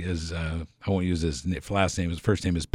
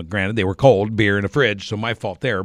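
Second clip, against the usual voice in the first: ordinary delivery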